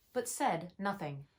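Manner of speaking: ordinary delivery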